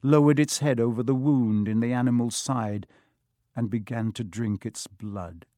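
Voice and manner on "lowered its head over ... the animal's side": plain reading voice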